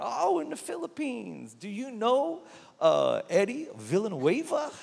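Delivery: put-on a sweet older Texan woman asking, high; imitating Texan lady.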